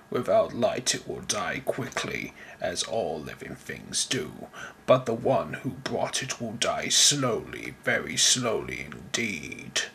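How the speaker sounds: low, soft sinister threat; menacing villain. Acted. Voiced villain threatens softly.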